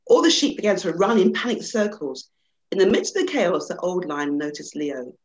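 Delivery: lively storybook reading with rising excitement; animated storyteller voice; story narration. A narrator's reading. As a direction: read it like a bedtime fable to an audience. Reads a children's fable aloud.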